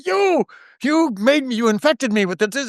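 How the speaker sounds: high, mock-accusing whine; joking accusation. Actor joking to a nervous guest on set, high mock accusation. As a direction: pin the blame on them as a joke. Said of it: "I'd turn it into a joke".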